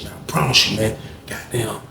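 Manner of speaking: usual speaking voice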